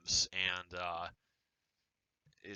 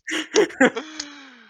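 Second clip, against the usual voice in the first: high, loud burst of laughter; 11 dB louder than usual. Real, not acted. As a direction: crack up loudly, high-pitched. Laughing at the trolling.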